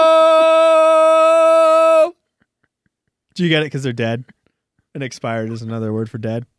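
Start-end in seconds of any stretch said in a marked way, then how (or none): 0.1-2.1 very high, loud sustained "hey-o"; drawn-out punchline call. Pretend. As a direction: belt it out like a hammy comic. Punchline tag after own pun.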